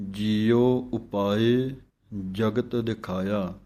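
ਜੀਉ and ਉਪਾਏ kept distinct, the two u sounds not merged; slow careful ਜੀਉ ਉਪਾਏ; separate vowels. Shown as a demonstration. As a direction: correct path. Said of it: "ਦੋਵੇਂ ਊੜਿਆਂ ਨੂੰ mix ਨਹੀਂ".

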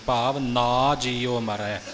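ਨਾ said as a long open naa, slowly; slow careful ਨਾ as naa; long ਨਾ with kanna. Shown as a demonstration. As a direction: draw out ਨਾ with the full aa vowel. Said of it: "ਇੱਥੇ ਕੰਨਾ ਹੈ".

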